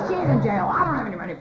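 loud, high mock rant; screaming dad. Dad shouting about bail money, loud retold rant. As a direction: holler it like a fed-up dad. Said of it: "he's screaming his head off".

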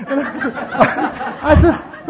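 real laugh breaking up his words, slow; cracking up mid-sentence. Real, not acted. Telling his audience the story, laughing through it.

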